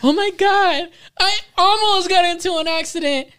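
put-on high panicky girlfriend voice; mocking the girlfriend's panic, high; girlfriend panicking. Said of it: "She's like".

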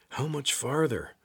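anxious, high and slow. Acted. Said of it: "Jord's anxious voice reverberated".

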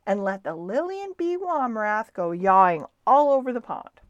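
loud, high-spirited promise; cheerful. A narrator's reading. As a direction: announce the plan with cheerful confidence. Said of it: "said Stewart cheerfully".

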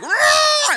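a huge high yelled blast like a car horn; angry horn blast. Retold or imitated. Angry driver leaning on the horn at her, loud horn yell. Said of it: "they laid on their horn like".

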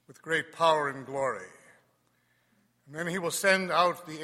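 solemn Gospel proclamation; cathedral echo. Acted.

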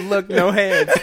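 loud words through laughter; laughing. Retold or imitated. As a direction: laugh while quoting him. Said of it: "And he said".

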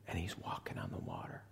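awed hushed whisper. Acted. Preacher hushing the congregation at the miracle, whispered. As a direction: breathe it in wonder. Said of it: "whispered narration".

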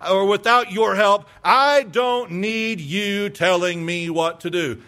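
语气强硬、音量提高的拒绝; defiant refusal. Retold or imitated. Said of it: "Sounds something like this".

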